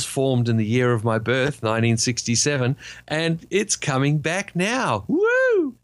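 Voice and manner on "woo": high, drawn-out "woo"; excited whoop. Real, not acted. Fan cheers the livery coming back, excited whoop. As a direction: let out a high-pitched cheer.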